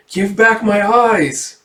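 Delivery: high, slow, drawn-out wail; acted, slow high-pitched plea; wailing demand. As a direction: draw the words out high like a haunting child. Voices eyeless child's demand.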